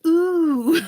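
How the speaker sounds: high, loud delighted ooh. Pretend. Herself falling for the lure, high thrilled ooh. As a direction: fall for the bait with glee. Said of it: "I'll be like".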